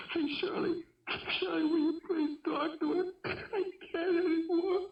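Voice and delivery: begging for rescue from the call; desperate plea. Acted. Son begging the host lady to take over the phone, desperate. Son gives up, begs Shirley.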